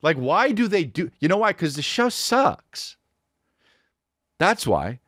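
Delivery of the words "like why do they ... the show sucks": high, strained indignation; exasperated rant. Acted. Ranting to listeners about a TV show, exasperated. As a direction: rail against it in a high pitch.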